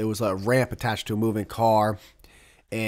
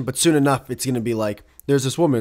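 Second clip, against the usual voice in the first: ordinary delivery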